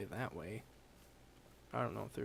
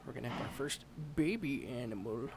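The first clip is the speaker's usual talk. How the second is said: ordinary talk, nothing marked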